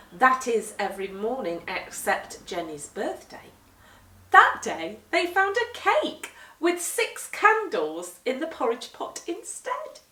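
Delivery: usual speaking voice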